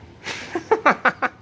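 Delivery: sudden high-pitched cackle; high burst of laughter. Real, not acted. Laughing at his own embarrassing story, high cackle. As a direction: break into a squeaky laugh.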